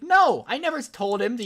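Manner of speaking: high, loud retold denial; acted-out outraged objection; indignant denial. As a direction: burst out protesting the accusation. Denies dean's accusation.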